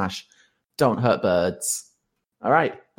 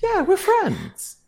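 much higher, slow creepy voice; high, drawn-out sinister friend; odd high voice; slower than usual. Acted.

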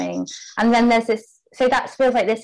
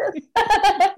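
light laugh; 10 semitones higher than usual. Real, not acted. Laughs after "keep me posted.

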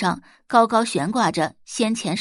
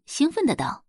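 excited calling; 6 semitones higher than usual. A narrator's reading. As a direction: call out excitedly.

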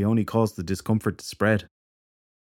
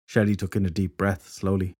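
ordinary delivery, nothing marked

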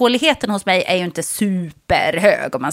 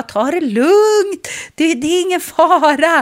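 reassuring dentist; 6 semitones higher, slower than usual. Retold or imitated. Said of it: "Och bara".

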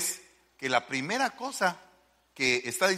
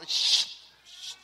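imitated swab hiss. (siseo imitado del hisopo)

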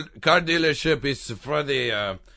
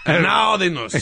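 mock news intro; 2 semitones higher than usual.

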